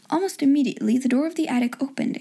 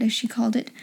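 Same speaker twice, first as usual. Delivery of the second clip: plain reading voice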